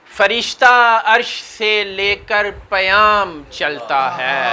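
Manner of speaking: measured, declamatory Urdu poetry reading; mushaira verse recitation. Acted. Poet to mushaira audience, stately verse recitation. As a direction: draw out the phrases, falling at each refrain.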